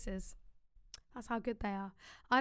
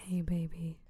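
low seductive purr; breathy come-on; sultry voice; 7 semitones lower than usual. Shown as a demonstration. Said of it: "sultry voice".

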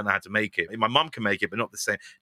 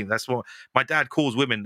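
normal talking voice

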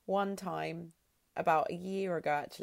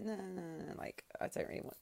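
near-whispered lazy no; soft refusal; 11 dB quieter, faster than usual. Retold or imitated. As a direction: mumble it, half-whispered. Retold refusal to boyfriend.

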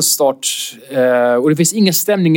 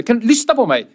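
higher, heated telling-off; scolding; 8 semitones higher than usual. Retold or imitated. Comic telling off the heckler, heated and higher. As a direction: put the heckler in her place. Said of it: "skällde ut honom".

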